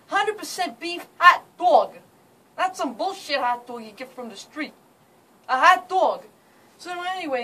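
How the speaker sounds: brash New Jersey accent; thick New York and Jersey street accent. Shown as a demonstration. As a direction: talk like a guy from New Jersey. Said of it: "I'm going to demonstrate his accent".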